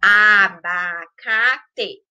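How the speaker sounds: "abacate" slow, syllable by syllable: a-ba-ca-te; separado em sílabas. Shown as a demonstration. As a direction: stretch each syllable of "abacate" apart. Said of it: "eu separei em sílaba". ("abacate" silabado, bem devagar)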